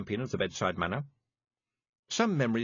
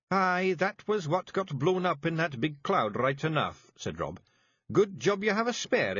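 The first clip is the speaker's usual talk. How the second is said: high, thick Scots pixie voice; small tough Scots fairy breaking bad news; Scottish Feegle voice; 7 semitones higher than usual. A narrator's reading. Feegle Rob.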